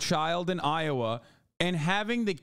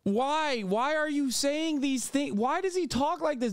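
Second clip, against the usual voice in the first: higher, slow incredulous questions; exasperated disbelief; 8 semitones higher than usual. Real, not acted. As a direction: plead at him in disbelief. Exasperated rant at politician.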